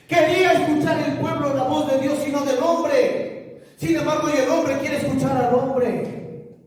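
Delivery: high, forceful pulpit cry; full-force preaching. Real, not acted. Preacher warning the church about following man, full-force preaching.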